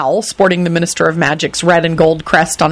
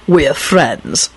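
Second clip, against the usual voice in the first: ordinary delivery, nothing marked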